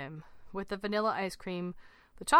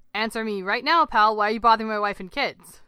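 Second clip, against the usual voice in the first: loud, forceful questioning; aggressive demand; 2 semitones higher, 8 dB louder than usual. A narrator's reading. Swimmer demanding why his family is bothered, aggressive. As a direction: demand an answer with full force.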